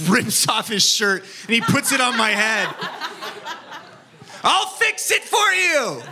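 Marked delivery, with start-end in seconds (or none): 4.4-6.0 put-on much higher, slow earnest child voice; lightly voiced young boy; kid friend voice. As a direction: play the eager little helper.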